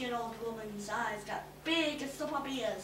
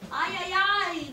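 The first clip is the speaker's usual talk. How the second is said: groan; 7 semitones higher, 9 dB louder than usual. A narrator's reading. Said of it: "the lizard groaned".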